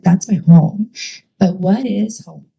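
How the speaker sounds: usual speaking voice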